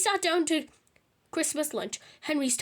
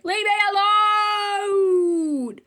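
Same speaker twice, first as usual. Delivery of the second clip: slow, drawn-out, much louder cry, higher; exasperated shout; 7 semitones higher, 9 dB louder, much slower than usual. A narrator's reading. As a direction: stretch it out like he's fed up. Dad snaps at nagging Granny.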